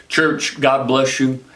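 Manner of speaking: normal talking voice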